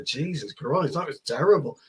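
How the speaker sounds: ordinary delivery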